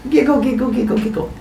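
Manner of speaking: high, spoken-out giggling; mock laughing. Goddess walks away from her victim, high mock giggling. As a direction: sing out a pretend giggle. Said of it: "Hera walks away laughing".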